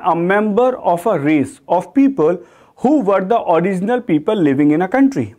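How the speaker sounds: slow, deliberate dictation of the meaning; slow definition read. Shown as a demonstration. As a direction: spell out the definition slowly for students. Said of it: "teacher reads the definition". (धीरे-धीरे परिभाषा पढ़ना)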